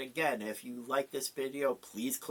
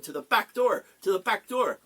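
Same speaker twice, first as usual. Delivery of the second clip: urgent call; 5 semitones higher, 5 dB louder than usual. Acted. Barkeep urging Cuchulainn to flee.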